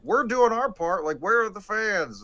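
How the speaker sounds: mock-indignant team owner; blaming the fans. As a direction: imitate an owner griping at the fans. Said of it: "he was basically blaming the fans".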